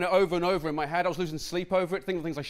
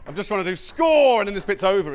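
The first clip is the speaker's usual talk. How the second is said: louder, pushing through; insistent; 4 semitones higher, 9 dB louder than usual. Real, not acted. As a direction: firmly push through the interruption. Insisting to heckler.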